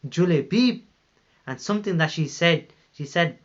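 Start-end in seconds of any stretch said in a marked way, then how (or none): 0.1-0.8 raised, indignant calling of the name; retold shout of a name. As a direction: cry out the name in protest. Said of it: "she started shouting".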